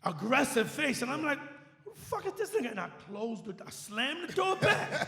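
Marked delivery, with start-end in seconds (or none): 1.8-2.7 high, indignant retold grumble, not shouted; upset. DJ retells a stranger barging into booth, upset. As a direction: replay offended disbelief at a stranger. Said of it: "I'm, I'm upset".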